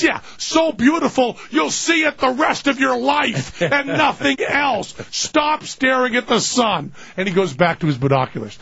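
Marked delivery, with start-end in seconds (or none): 0.0-6.9 high, slow mocking rebuke; mock him slowly and scold; sarcastic scolding. Tim scolding his friend about the sun, slow sarcastic rebuke.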